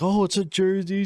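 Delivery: high excited cousin voice; imitating cousin. Retelling how the cousin reacted to her gift, high and excited. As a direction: squeal it like the cousin opening a gift.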